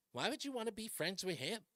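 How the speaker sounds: hushed worry over a friendship; mock worried question. Host mocks Democrats fretting over Kennedy, softly worried. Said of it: "Why would you be saying stuff like".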